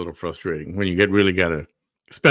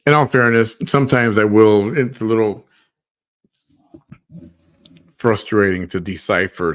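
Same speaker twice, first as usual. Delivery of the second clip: loud interjection; 2 semitones higher, 9 dB louder than usual. Real, not acted. Chiming in loudly off-mic.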